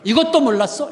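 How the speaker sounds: lightly voiced child lecturing his parents; cocky child. Six-year-old teaching mom and dad, smug. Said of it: "얼마나 확신하는지 엄마 아빠를 가르쳤어요".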